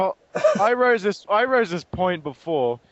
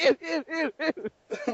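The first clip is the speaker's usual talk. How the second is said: higher squealing ew; grossed-out Optimus; 9 semitones higher, 4 dB quieter, faster than usual. Retold or imitated. Optimus covered in body parts, squealing disgust. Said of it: "he's like".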